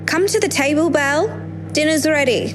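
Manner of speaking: put-on calling out to a child; calling someone home. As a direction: call her in for dinner. Mother calling child to dinner.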